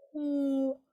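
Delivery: high, loud held hoo; owl hoot. Shown as a demonstration. Teacher recalling the hoot for practice, owl hoot. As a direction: sound a round owl hoot. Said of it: "making that hooting like an owl".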